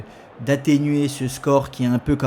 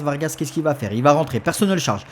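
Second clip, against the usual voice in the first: normal talking voice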